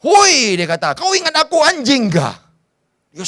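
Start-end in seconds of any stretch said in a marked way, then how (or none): 0.0-0.6 high, sharp mock yell at the boy; angry shout. Goliath yelling at David as he comes near, angry mock shout. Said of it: "Dia terus marah". 1.0-2.4 angry. Retold or imitated. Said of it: "Dia terus marah".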